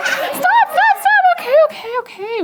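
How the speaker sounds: very high, loud frantic shouting; panicked letter U. Acted. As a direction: scream in a total meltdown. Letter U freak out meltdown.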